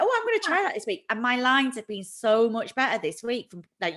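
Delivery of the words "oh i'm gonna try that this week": high-pitched, keen re-enacted thought; eager reaction. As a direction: act out jumping at an idea. Acts out own keen reaction.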